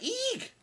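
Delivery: high, tiny mock shriek of fright; sudden high-pitched eek; mock squeal. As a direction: squeak it out like a startled cartoon. Everyone's getting angry, mock reaction.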